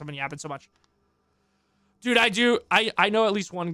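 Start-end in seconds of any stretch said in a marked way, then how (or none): 0.0-0.7 hoarse voice. Real, not acted. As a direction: voice wearing out from talking. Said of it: "I'm losing my voice".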